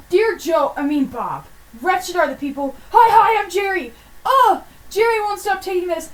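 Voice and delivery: put-on high, loud, shouty kid voice; excited child yelling through a letter; hammed-up kid letter. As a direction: read it like a wild ten-year-old wrote it. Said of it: "written by ten-year-olds".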